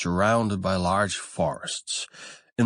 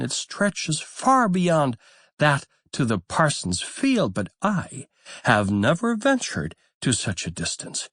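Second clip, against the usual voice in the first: higher, lively motherly voice; mother duck; 8 semitones higher than usual. A narrator's reading. Mother duck tells her ducklings about the garden, higher and lively. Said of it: "she continued".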